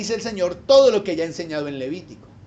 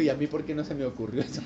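imagined Moses; 6 semitones lower, 6 dB quieter, faster than usual. Retold or imitated. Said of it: "Moisés dijo".

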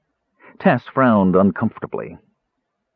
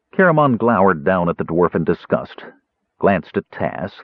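usual speaking voice

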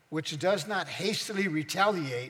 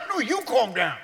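mock angry retort; 7 semitones higher, 6 dB louder than usual.

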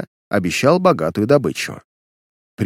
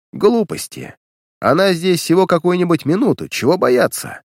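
higher-pitched, offhand brush-off; careless dismissal; 8 semitones higher than usual. A narrator's reading. Criminal brushes off his anxious accomplice, carelessly. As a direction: wave the worry away carelessly. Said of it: "небрежно заметил Баглей".

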